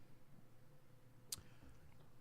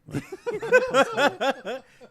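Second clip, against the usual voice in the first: big burst of laughter; loud laugh; 13 semitones higher, 13 dB louder than usual. Real, not acted. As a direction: laugh out loud, high and hard.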